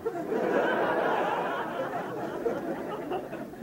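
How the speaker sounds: a long, high, breathy laugh fading off; airy laugh. Acted. [name] laughing at his own joke, airy laugh. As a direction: let the laugh run out.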